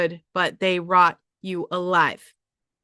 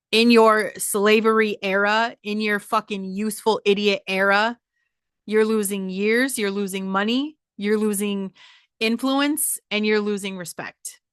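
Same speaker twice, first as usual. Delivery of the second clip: ordinary talk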